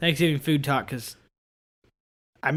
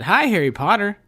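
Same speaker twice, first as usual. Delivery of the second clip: phone greeting; 6 semitones higher, 3 dB louder than usual. Retold or imitated. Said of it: "I answered the phone and I said".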